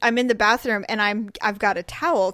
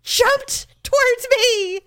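high, slow, wailing protest; hammed-up high-pitched wail; desperate insistence; 13 semitones higher, slower than usual. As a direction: drag out each word to be believed. Insisting to doubting husband.

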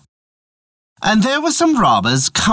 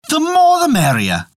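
cheerful shout; 12 semitones higher than usual. Acted. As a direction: call it out gladly. Jack welcomes the cat.

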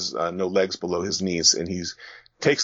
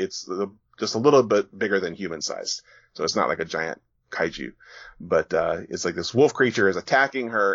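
ordinary delivery

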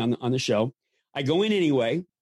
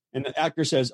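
ordinary delivery

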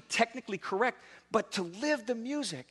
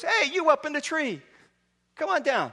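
calling out; 8 semitones higher, 4 dB louder than usual. Acted. Jesus calls up into tree.

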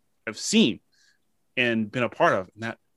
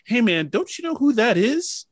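much higher, louder voice of a man stepping in; intervening bystander; 12 semitones higher, 6 dB louder than usual. Retold or imitated. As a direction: play the guy who steps in and calls them off.

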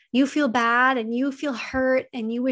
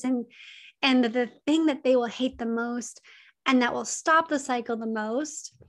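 ordinary talk, nothing marked

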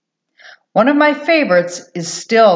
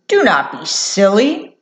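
plain reading voice

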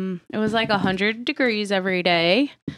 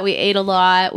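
ordinary delivery

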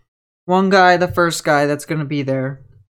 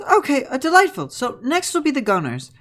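higher, prim and polite professor voice; a courteous school teacher, pitched up; professor lady; 8 semitones higher than usual. Acted. Professor lady welcoming the adventurers, higher and polite. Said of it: "He goes".

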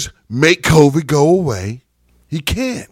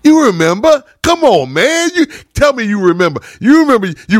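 insistent challenge; 5 semitones higher than usual. Real, not acted. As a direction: call them out, demanding an answer. Pressing listeners about stimulus checks.

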